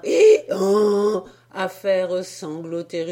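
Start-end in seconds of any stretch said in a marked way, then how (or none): 0.0-1.2 loud braying hee-haw; donkey bray. Acted. Donkey answering the sailors, loud bray. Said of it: "Il y répondit cependant par un".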